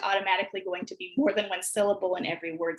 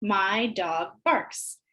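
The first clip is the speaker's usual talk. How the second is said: very slow, joined sentence; very slow blend; much slower than usual. Shown as a demonstration. Teacher rejoining the words, very slow. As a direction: stretch the sentence out slowly. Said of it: "each word we hear in the sentence".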